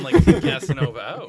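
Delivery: laughing through the punchline; blurted out through laughter; a loud laughing burst. Real, not acted. Joking about bumming out.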